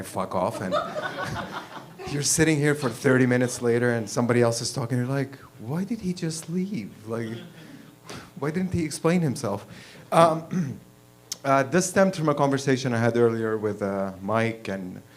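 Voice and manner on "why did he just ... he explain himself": normal talking voice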